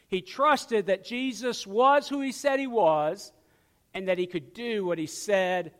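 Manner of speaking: ordinary talk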